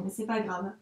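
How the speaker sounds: ordinary talk, nothing marked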